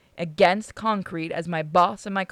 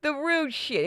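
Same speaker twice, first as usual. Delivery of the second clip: slow, hammed-up New York accent; pushy put-on New York voice; rude New Yorker accent; 5 semitones higher, 5 dB louder, slower than usual. Shown as a demonstration. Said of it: "New Yorker like this".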